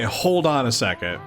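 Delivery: normal talking voice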